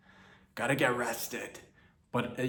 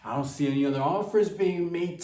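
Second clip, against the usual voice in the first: mock hard bargainer; 5 semitones higher than usual. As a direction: slam down a lowball offer. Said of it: "be like".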